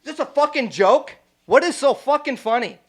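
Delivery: high, loud imitated yelling; mock yell. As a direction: blast it like a furious big guy. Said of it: "He's like".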